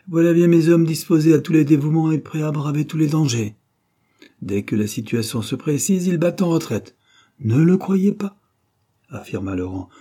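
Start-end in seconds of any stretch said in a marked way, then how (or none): 0.1-3.6 higher, louder, indignant taunt; disdainful outburst at a hesitant suitor; scornful reproach. Acted. Violetta scorns her hesitant suitor. 5.6-7.0 higher-pitched, mocking accusation; disdainful, lifted taunt; scornful reproach. Violetta scorns her hesitant suitor. 7.4-8.4 high, loud, slow denial; drawn-out, urgent objection; emphatic protest. Acted. Laurent protests at the accusation.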